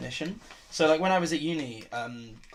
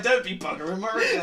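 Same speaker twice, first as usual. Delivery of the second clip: laughing requote; 5 semitones higher than usual. Retold or imitated. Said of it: "and I'm like".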